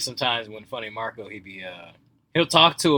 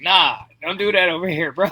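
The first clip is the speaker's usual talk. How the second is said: raised, higher warning yell; half-joking shout across the store; mock shout; 5 semitones higher, 4 dB louder than usual. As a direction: call it out like an annoyed shopper. Acting out angry shoppers.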